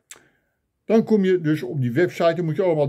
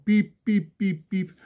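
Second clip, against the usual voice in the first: high little "piep" beeps; short, high-pitched mock phone tones; imitated phone beeps; 4 semitones higher, slower than usual. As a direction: mimic a dead line beeping. Imitates the cut phone line's beeps.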